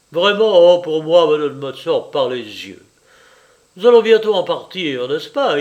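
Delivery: loud, hearty husband; the general, breezy. A narrator's reading. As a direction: hold forth loudly as the general. (général expansif, voix forte)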